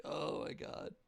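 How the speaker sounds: awed whisper. Retold or imitated. Stunned reaction to the rant.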